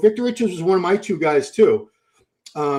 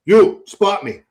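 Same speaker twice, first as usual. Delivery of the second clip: louder, curt order to a gym underling; bossy command; 3 semitones higher, 8 dB louder, slower than usual. Retold or imitated. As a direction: imitate a blunt, loud demand. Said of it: "He would tell me to spot him".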